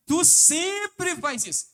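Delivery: very high, loud, slow sneer; mock scornful accusation. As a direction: stretch it out like a contemptuous spouse. Acting out contempt again.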